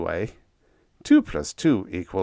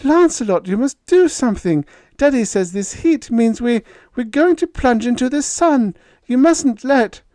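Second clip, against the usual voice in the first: much higher, louder frightened pleading; fearful plea; 8 dB louder than usual. A narrator's reading. Diane begging Lancelot to save them, fearful. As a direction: beg him in a panic. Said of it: "She cried".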